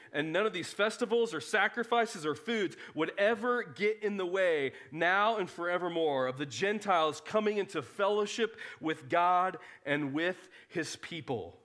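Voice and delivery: ordinary delivery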